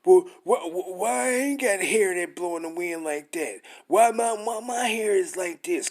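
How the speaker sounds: loud, high stammering mock whine; mocking whiner. Preacher mocking Israelites complaining about their hair, high whine. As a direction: ham up a whining complainer, stuttering. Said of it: "y'all need to stop saying".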